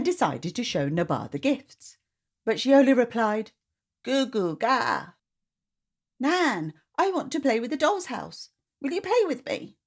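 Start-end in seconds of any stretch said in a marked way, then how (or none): 4.0-5.1 put-on baby babble. 6.2-9.8 put-on high, sweet little-girl plea; child voice coaxing grandma; pleading child. Child asking Nan.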